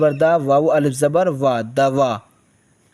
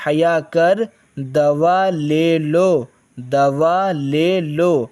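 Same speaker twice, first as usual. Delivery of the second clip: slow dictation; slower than usual. Shown as a demonstration. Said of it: "teacher reads slowly".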